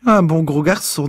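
put-on high, loud old woman's remark; high-pitched old-lady voice. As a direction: pipe it up as a doddery octogenarian. Old Miss Trixie eyes the newcomer.

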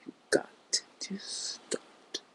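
normal talking voice

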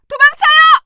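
high, loud scream to flee; panicked shout. Acted. Citizen screaming at the doctor to run, panicked shout. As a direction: yell at everyone to run.

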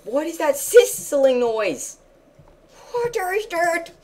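high, slow scared cry; alarmed wail; frightened high question. Real, not acted. Scared of sizzling noise.

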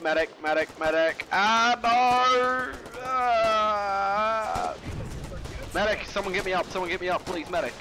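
wounded soldier calling for a medic; man down shout. Pretend. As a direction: cry out for help, urgent. Downed in game, calling medic.

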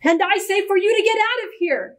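high, indignant order to leave; crying out angrily. A narrator's reading. Orestiel throwing Victor out, crying out angrily. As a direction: order them out, pitched high. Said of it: "cried Orestiel".

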